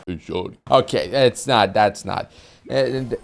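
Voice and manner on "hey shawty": low, soft mock smooth-talker; imitating a friend. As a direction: drop it low and croon it. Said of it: "a friend who actually talks like that".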